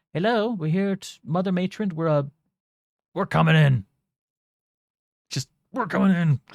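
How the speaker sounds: loud, high call through a door. Acted. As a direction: call in nervously.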